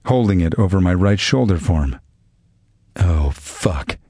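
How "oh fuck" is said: low, soft groan of dismay. A narrator's reading. Gunman groaning as the dog lunges at the door. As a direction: let the curse sink out as a groan. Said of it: "he groaned".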